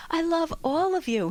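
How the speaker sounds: high, loud, showy gush; gushing award thanks. Shown as a demonstration. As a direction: beam it out to the audience. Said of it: "an award at Toastmasters".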